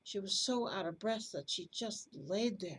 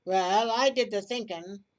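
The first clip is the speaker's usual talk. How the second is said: angry quarrel; 10 dB louder than usual. Acted. Robbers quarrel over the money.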